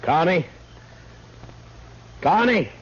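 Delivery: calling out, worried. Acted. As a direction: call his name across the river, growing alarmed. Calling out for missing Connie.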